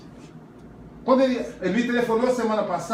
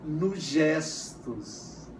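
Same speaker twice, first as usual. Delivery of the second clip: softer, lower, very slow "nos gestos"; drawn-out teacher emphasis on "nos gestos"; 5 semitones lower, 6 dB quieter, much slower than usual. Shown as a demonstration. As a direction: stretch "nos gestos" out slowly and gently. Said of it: "o afeto também se manifesta".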